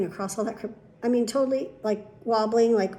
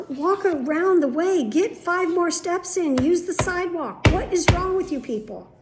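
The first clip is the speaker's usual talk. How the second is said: exasperated rant; 7 semitones higher, 4 dB louder than usual. Retold or imitated. Patient silently scolding people crossing the berm, exasperated. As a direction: stretch out each instruction in exasperation.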